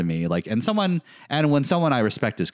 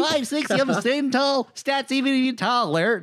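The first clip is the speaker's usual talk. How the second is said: squeaky, much higher cartoon voice; high-pitched goofy impression. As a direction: brag in a tiny squeaky voice. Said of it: "That's not how I speak".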